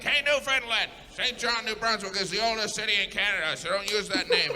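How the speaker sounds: high, brisk complaint; confronting Newfoundland. Acted. New Brunswick scolding Newfoundland over the name, high and brisk. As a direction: scold the other province. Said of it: "Nobody confronted Newfoundland like".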